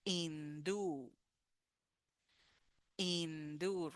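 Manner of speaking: hindú with silent h and stress on the end, in-DÚ; teacher modelling hindú; model word. Shown as a demonstration. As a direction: drop the h and hit the final ú of hindú. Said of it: "it's with the stress".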